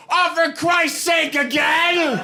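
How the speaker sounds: a fed-up uncle who has heard this before; exasperated uncle. Retold or imitated. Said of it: "my uncle goes".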